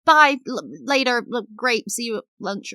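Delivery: hammed-up awkward chirp; flustered over-eager reply. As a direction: stumble after someone who already left. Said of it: "and I'm like".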